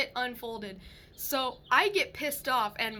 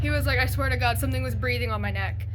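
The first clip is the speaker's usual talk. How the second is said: louder, insistent and rattled; shaken; 3 semitones lower, 9 dB louder, faster than usual. Retold or imitated. Husband insisting to wife something breathed on him, shaken. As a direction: swear it's true while still shaken.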